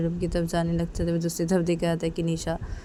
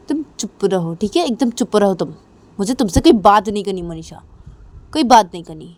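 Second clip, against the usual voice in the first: loud, forceful order to be silent; angry rebuff; 9 dB louder than usual. Acted. Nisha refusing to talk to Manisha, angry and loud. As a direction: shut the other one down hard. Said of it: "और क्या इतना गुस्सा कर रहे हो".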